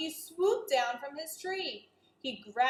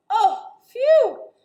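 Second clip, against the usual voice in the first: ordinary delivery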